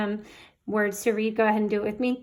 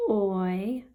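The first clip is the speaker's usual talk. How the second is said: normal talking voice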